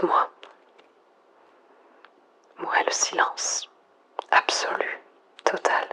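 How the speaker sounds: soft, breathy near-whisper into the mic; quiet, intimate monologue alone at night; hushed whisper. Acted. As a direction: whisper as if afraid to break the silence. Lone survivor whispering into mic.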